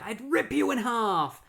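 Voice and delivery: high-pitched threat at normal volume; hammed-up burst of rage, pitched high; mock angry threat. Retelling Magenta turning on Gerda, mock angry threat.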